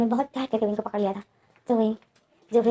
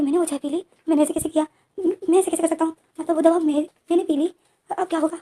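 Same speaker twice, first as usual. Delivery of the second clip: panicked worry; 6 semitones higher than usual. Retold or imitated. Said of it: "wing जो shock में हो जाता है".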